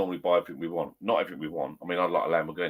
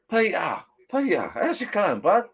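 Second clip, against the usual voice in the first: friend calling out; 11 semitones higher, 3 dB louder, slower than usual. Retold or imitated. Old friend calls across pub.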